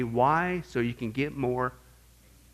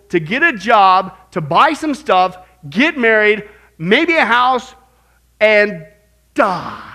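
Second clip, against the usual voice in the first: loud, slow and high, spelling out each step; mock outraged rant; 5 semitones higher, 10 dB louder, slower than usual. Preacher mocking life's empty cycle, loud and slow. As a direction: rant at how pointless it all sounds.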